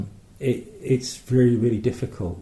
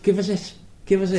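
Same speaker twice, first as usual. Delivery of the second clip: high, insistent mock Scouse; Liverpool accent; 8 semitones higher, faster than usual. Liverpool call handler demanding bank details, high Scouse. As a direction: badger them in a Liverpool accent. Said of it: "But not Liverpool".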